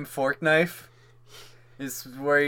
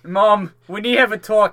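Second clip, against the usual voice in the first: loud, high mock Jersey accent; 6 semitones higher, 7 dB louder than usual. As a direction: confront Mom in a hammed-up Jersey accent. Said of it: "like, a Jersey accent".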